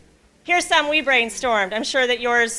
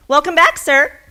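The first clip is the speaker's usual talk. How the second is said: higher, louder bright greeting; cheerful welcome; 5 semitones higher, 6 dB louder than usual. Acted. Host welcoming the old man back, higher and louder. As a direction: greet him with delight.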